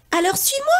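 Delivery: put-on very high boyish command; a kid taking charge; bold boy. Pierre tells Sacha to follow, very high.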